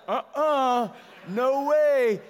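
put-on high, loud kid refusal; refusing kids. Kids at the top refusing dad, high loud protest.

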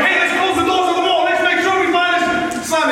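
high-pitched retold shout to a crowd; urgent call out. Bystander calling to close the mall doors, urgent shout. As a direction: imitate someone sounding the alarm.

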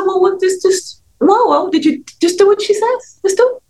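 high, pushy voice of the friend; high mock exasperation; friend's exasperated voice. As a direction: squeak it out as the impatient friend. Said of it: "And the friend said".

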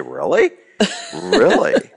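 drops low, then an eager, laughing really; mock surprise. Friend tempts him with gossip, he asks again with mock surprise. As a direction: pretend shock turning into glee. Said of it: "I'll say".

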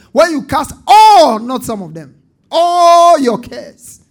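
loud rising preacher's cry on every "when"; preaching at full force. Acted. Pastor drives the crowd to cast all cares, full-force preaching.

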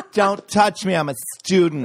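high, loud entitled whine; a spoiled kid yelling at a teacher; mock bratty student.

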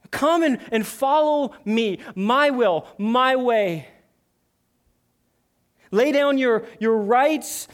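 high, loud, emphatic sermon delivery; full-force preaching. Acted. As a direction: preach it out as Christ's urgent plea. Preaches in Jesus's words.

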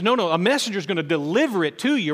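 no no, this is a big deal. Pretend.

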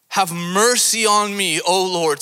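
loud, high plea held long on mercy; crying out. Acted. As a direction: call out for mercy, drawn out. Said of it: "crying out".

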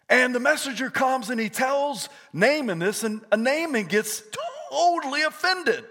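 high, punchy sermon storytelling; energetic pulpit retelling, key words stretched high; animated preaching. Acted. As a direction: preach the story with swooping emphasis.

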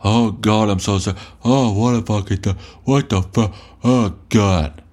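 mock panicky whimpering whine; whining impression. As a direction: parody the listener's fretting. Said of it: "bitching and whining about how".

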